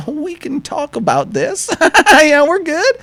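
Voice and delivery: high, scared squeak; panicked placating voice way up high; backing off in a mock frightened squeal; nervous pleading. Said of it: "and I'm like".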